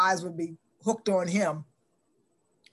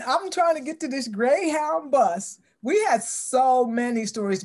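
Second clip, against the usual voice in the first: normal talking voice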